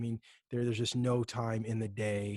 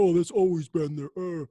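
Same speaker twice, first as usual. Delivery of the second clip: mock PC gamer; 5 semitones higher, 7 dB louder than usual. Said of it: "PC gamers are gonna be like".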